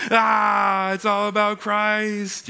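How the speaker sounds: high, drawn-out cry of mock awe; hammed-up climactic sermon line, stretched out slowly; mock revelation. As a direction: stretch it out like a grand reveal. Mimics his excited anticipation of sermons.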